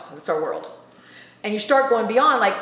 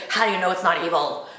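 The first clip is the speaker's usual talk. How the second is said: low, dark evil voice; spooky evil voice on evil; 4 semitones lower than usual. Acted. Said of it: "Why'd you do your evil voice when you say evil?".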